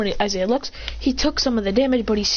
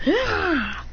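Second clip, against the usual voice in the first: acted cry; 9 semitones higher than usual. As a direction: let out a short high cry. Figure's cry as clothes change.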